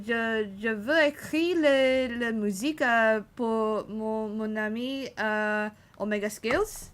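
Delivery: hammed-up French accent, halting; a streamer showing off beginner French to chat; French practice. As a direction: put on a playful French voice with hesitant pauses. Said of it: "I'm practicing French".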